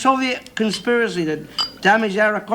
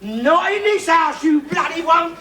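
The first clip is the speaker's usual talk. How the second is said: higher, slow, shouted refusal; slow angry shout; 7 semitones higher, slower than usual. Real, not acted. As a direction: plant your feet and bellow it out. Row over saving fuel.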